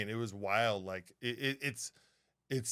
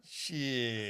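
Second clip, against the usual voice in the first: long, softer stretched curse; drawn-out expletive; 3 semitones lower, 6 dB quieter than usual. Shown as a demonstration. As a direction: stretch the one word right out. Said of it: "You know what I got to say about that?".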